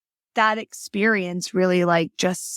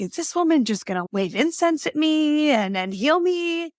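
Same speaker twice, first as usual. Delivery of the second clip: higher-pitched, scoffing retold thought; mock skeptical disbelief; 8 semitones higher than usual. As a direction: poke fun at her own old doubts in a raised voice. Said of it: "And I'm like".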